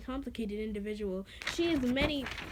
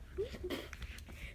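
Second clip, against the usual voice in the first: breathless wheezing laugh; silent wheeze laugh; 8 semitones higher, 12 dB quieter than usual. Real, not acted. Reader laughing at her baka joke, silent wheeze. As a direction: crack up silently.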